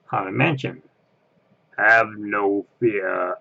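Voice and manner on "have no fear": slow eerie voice; spooky ride voice. Retold or imitated. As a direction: intone it like a ghost host. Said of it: "Oh, no, that's not it".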